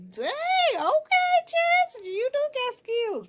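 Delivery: high, loud hyped-up praise; impressed. Pretend. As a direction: gush like a stunned fan. Impressed by the tattoo.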